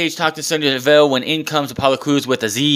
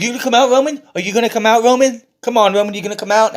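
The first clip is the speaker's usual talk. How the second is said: high-pitched, repeated taunting call; mock sing-out challenge to a rival; Daniel Bryan; 11 semitones higher, 4 dB louder, faster than usual. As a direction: goad him louder and higher, imitating a wrestler. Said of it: "Daniel Bryan is still taunting".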